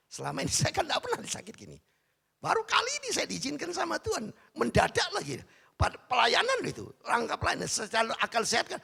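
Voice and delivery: high, loud testimony from the pulpit; exclamatory preaching. Real, not acted. Preacher telling how sickness struck during ministry, exclamatory=. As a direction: push it out high, as if incredulous.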